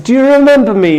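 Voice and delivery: high, loud bright question; eager call. Retold or imitated. Salesman calling a stranger, eagerly upbeat. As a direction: sound hopeful on the phone.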